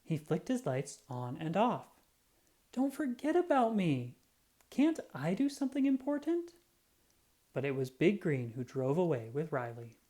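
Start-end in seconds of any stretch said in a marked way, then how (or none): 2.7-6.5 high small pleading voice; a small truck begging to be included; little truck pleading. Acted. Little Yellow asks Riley not to forget him, high and pleading. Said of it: "Little Yellow waited".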